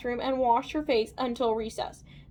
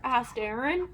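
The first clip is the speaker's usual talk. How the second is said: ordinary delivery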